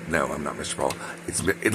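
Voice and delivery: usual speaking voice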